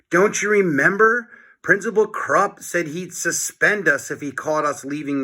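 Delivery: put-on quick boyish protest; Harold, lightly voiced. A narrator's reading. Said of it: "said Harold".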